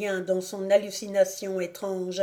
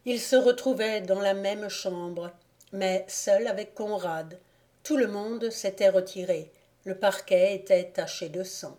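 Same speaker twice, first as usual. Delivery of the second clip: plain reading voice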